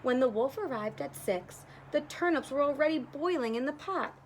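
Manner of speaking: ordinary delivery, nothing marked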